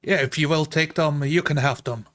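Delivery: usual speaking voice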